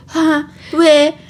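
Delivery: high, halting imitation of speechless stammering; mom's flustered sputter. Retelling the mom's brain melting, mock speechless sputtering. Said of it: "And the mom's like".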